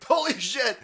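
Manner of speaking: high, loud mock panic, laughing through the whoa; hammed-up freak-out; mock panicked agent. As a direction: play a panicking agent, yelping. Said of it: "It's just like".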